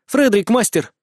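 high, urgent female voice. Acted. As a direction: blurt out the alarming news. Distressed student brings news.

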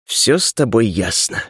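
plain reading voice

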